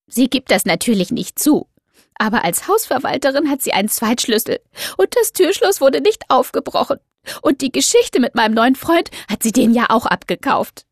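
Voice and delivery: high, loud, indignant; upset young woman. Acted. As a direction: insist bitterly. Doro accusing neighbour.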